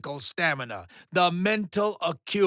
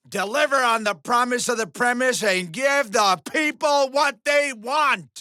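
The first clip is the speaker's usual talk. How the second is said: demanding shout; 4 semitones higher than usual. Acted. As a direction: bellow orders at the cast. Director yelling at contestants.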